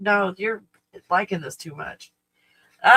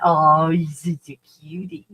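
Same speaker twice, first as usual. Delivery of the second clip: doting praise; 6 dB louder, slower than usual. Acted. Praising the dog.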